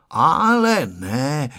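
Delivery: put-on long, drawn-out child's no; persistent refusal. A narrator's reading. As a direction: refuse stubbornly, stretching both words. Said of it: "odmítal vytrvale Honzík". (vytrvalé, protáhlé odmítání)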